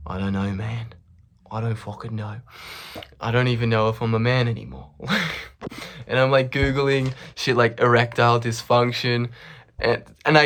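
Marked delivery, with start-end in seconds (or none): 0.1-4.9 dejected. Retold or imitated. Acted despair about his manhood.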